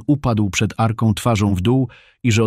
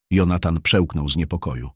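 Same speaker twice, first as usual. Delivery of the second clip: usual speaking voice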